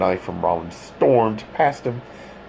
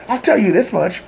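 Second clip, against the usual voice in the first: higher, louder disgusted snap; 13 semitones higher, 5 dB louder than usual. A narrator's reading. Todd snapping at the detective in disgust. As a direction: bite it off in disgust. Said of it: "snapped Todd in disgust".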